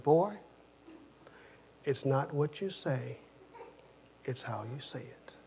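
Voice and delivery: soft, hushed motherly warning; mother's whisper. Retold or imitated. Mother quietly warning her son after being pulled over. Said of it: "my mother said".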